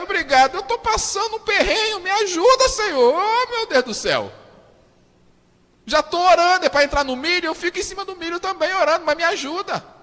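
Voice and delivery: high, loud cry for help; desperate plea. Retold or imitated. Preacher acting a desperate believer crying to God, loud. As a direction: beg God loudly, at the end of your rope.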